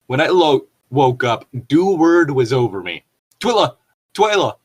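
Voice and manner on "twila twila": high, mock yell of a name. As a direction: call her name in a hammed-up shout. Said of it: "He screwed into my ear".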